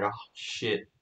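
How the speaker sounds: low, hushed mock dismay. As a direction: drop it right down as a sinking realisation. Guard's dismay at backup.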